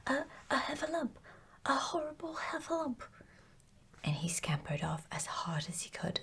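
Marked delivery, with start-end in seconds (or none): 0.1-3.0 high, fast, stammering alarm; panicked cry. A narrator's reading. Piglet cries out at seeing a heffalump, panicked. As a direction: squeal it out in storybook fright. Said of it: "cried Piglet".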